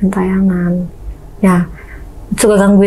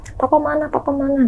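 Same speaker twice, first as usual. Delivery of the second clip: put-on scared child calling; 4 semitones higher, 6 dB quieter than usual. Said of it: "Gue teriak, karena gue takut".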